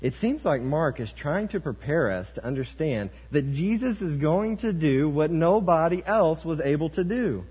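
high, loud, driving sermon delivery; impassioned preacher in full flow; forceful preaching. Real, not acted. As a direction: preach it hard, building in pitch and volume.

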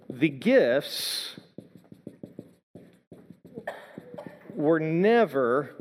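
drawn-out words with long gaps; slow dictation. Real, not acted. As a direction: dictate slowly, waiting between phrases. Dictating next point while writing.

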